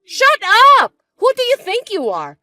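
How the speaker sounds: screamed, high and loud; angry shout. Acted. Stepsister screaming at her over the rent.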